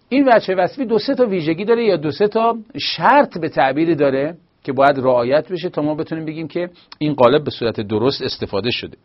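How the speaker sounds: usual speaking voice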